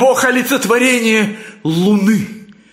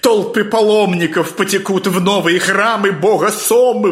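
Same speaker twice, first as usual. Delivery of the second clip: ordinary delivery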